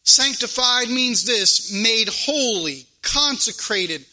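deliberate, emphatic scripture proclamation; measured preacher cadence with weight; preaching. Real, not acted. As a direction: read it out from the pulpit, stretching key words. Reads and expounds scripture.

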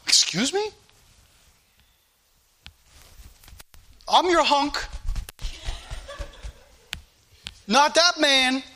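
hammed-up wounded pride, teasing; mock jealous indignation. A husband teasing his wife about a TV star, mock indignant. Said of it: "I'd be like".

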